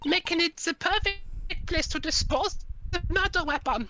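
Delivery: put-on Von Karma, German accent. Player explains the murder weapon as Von Karma, high German accent. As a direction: play it as an eager, high-pitched German caricature. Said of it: "doing my stereotypical German voice".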